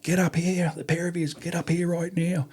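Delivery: high barked order from the boss; RSM yelling. Retold or imitated. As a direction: call them up like an angry sergeant major. RSM barking at corporals.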